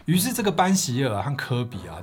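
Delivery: ordinary delivery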